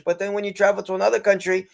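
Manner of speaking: normal talking voice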